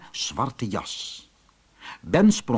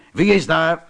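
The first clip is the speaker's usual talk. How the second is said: raised voice toward the door; higher, louder storybook call; 4 semitones higher, 5 dB louder than usual. A narrator's reading. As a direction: call out to an unseen visitor. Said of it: "riep Ben".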